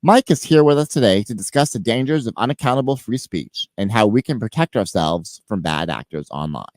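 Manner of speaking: ordinary talk, nothing marked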